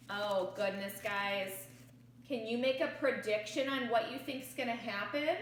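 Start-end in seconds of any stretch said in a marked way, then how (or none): none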